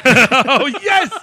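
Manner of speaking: a loud gleeful laughing yes; laughing delight. Real, not acted. Delighted by press secretary joke.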